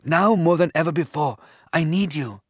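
a touch of desperate begging; silent pleading. A narrator's reading. Anand begs the silent conch for help, pleading. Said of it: "Anand cried silently to the conch".

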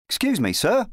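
put-on high-pitched polite boy; young lad. A narrator's reading. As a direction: ask politely as a small boy. Boy politely addresses the knight.

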